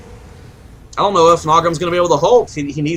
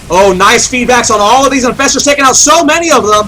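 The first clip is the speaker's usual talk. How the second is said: excited; 5 semitones higher, 5 dB louder than usual. Real, not acted. Big play excites caster.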